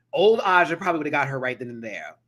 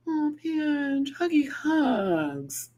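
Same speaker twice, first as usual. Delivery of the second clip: tender; 11 semitones higher, much slower than usual. Real, not acted. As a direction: wrap a viewer in a gentle hug. Comforting a struggling viewer.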